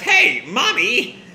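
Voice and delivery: high, drawn-out scolding call; snapping. Acted. Erika snaps at her laughing mother, high scolding voice. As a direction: call out in mild exasperation. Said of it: "Erika snaps".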